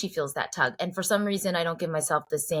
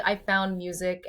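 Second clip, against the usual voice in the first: ordinary talk, nothing marked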